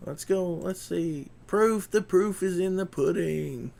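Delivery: loud, high-pitched cheering; excited. Real, not acted.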